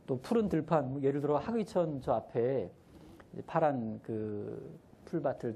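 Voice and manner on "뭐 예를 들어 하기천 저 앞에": ordinary talk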